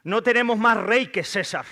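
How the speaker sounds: high, loud collective refusal; loud defiant declaration. A narrator's reading. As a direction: declare it forcefully as the chief priests. Sacerdotes rechazan a Jesús a voces. (declaración tajante a voces)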